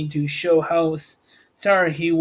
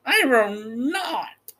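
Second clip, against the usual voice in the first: high, slow witch voice snapping back; witch retorting; 6 semitones higher, much slower than usual. Acted. The witch refuses Dorothy, retorting sharply. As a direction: refuse her flatly, witch-like. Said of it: "retorted the witch".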